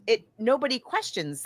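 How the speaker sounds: ordinary delivery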